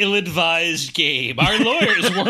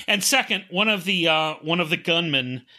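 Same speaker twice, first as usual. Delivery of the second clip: normal talking voice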